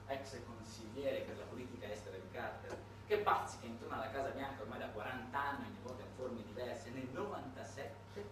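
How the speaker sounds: ordinary talk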